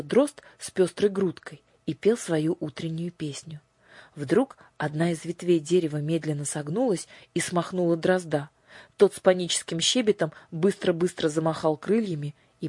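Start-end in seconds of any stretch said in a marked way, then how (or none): none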